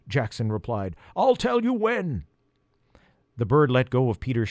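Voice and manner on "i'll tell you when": high crow voice, urgent; urgent instructions from a crow. Acted. Jackson the crow speaks.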